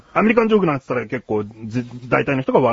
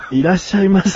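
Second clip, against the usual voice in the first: seductive night welcome; 4 semitones higher than usual. Pretend. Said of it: "夜のいらっしゃいませ".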